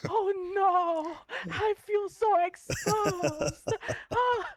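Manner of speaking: high, loud, drawn-out fake despair; hammed-up embarrassed howl; mock wail. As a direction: wail it slowly, hamming up the embarrassment. Playing up embarrassment at being found out.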